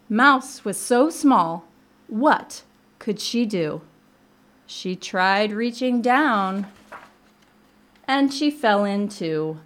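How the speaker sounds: very slow, suspenseful storybook narration; picture-book read-aloud. A narrator's reading. Librarian telling of Mouse's fall, slow dramatic storytelling. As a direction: draw out every phrase for small children.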